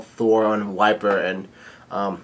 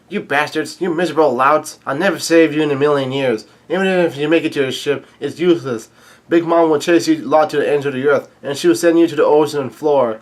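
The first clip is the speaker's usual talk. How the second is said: higher, insistent villain rant; evil Pudding; 4 semitones higher, 4 dB louder than usual. Retold or imitated. Pudding cursing the crew who fled, hammed-up villain rant. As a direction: hurl the insults and threats, hammed-up. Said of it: "Pudding has become evil again".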